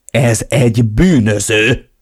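slow, indignant emphasis; upset, fuming. A narrator's reading. Narrator warns sister the man is criminal, fuming. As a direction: huff it out, worked up. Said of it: "Feldúltan fújtattam".

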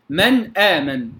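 من آمن slowly, still noon and clear hamza; Hafs izhar. Shown as a demonstration. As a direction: keep من apart from آمن without a hum. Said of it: "في رواية حفص". (إظهار حفص في من آمن)

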